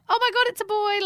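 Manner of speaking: high, put-on birth-room exclamation; playful high-pitched gasp of news; mock surprise. Imagined reaction at the birth.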